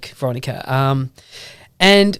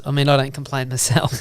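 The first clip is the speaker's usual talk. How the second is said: ordinary delivery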